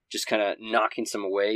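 ordinary talk

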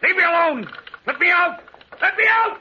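high, loud, desperate scream; terrified shriek. Acted. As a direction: shriek to be let out. Terrified man begs release.

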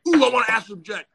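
mock eagerness. Lecturer imitating a keen would-be astral traveller, mock eager. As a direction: imitate an over-keen beginner in a rush.

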